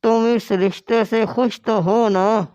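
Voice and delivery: put-on a kindly old father asking gently; old father-in-law. Father-in-law voiced in story.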